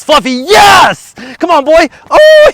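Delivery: high, slow, loud yes; excited shout. Real, not acted. Owner cheering poodle charging in, high loud shout. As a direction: whoop the dog in like a wrecking ball.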